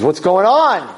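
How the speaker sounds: high, rising call of alarm; mild acted scream. Son calling to his brother stuck at sea, mild yell. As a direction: holler it over to him, not full force. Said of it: "And he screams to him".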